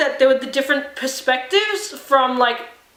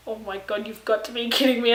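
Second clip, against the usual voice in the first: softly, half under the breath, mock disbelief; quiet playful disbelief; hushed disbelief; 4 semitones lower, 6 dB quieter than usual. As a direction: murmur it like you can't believe what you're seeing. Her reaction to the butt shot.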